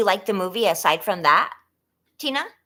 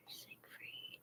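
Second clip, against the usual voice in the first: whisper; 31 dB quieter than usual. Real, not acted. Whispering a name while reading.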